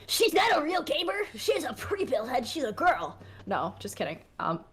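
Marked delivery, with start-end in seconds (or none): 0.1-3.2 high, whiny mock gatekeeper voice; mock an outraged Reddit mod in a higher pitch; Reddit mod impression. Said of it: "accurate, like, Reddit mod".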